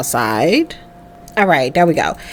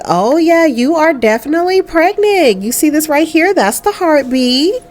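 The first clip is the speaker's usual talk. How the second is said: a touch higher, cheerful doctor breaking the news; lightly voiced doctor; 7 semitones higher than usual. Doctor tells Jamie she is pregnant, lightly voiced cheerful. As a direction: lightly play the upbeat doctor.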